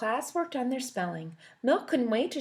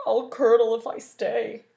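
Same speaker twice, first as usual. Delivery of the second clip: louder, disgusted huff; Milk leaving the smelly library; dramatic huff; 2 semitones higher, 9 dB louder than usual. Acted. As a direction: exit in a haughty huff. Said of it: "Milk started to leave".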